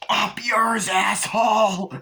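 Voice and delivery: high, loud drawn-out insult; mock furious shout. Driver shouting at Chucky, mock road rage. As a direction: yell abuse across the traffic.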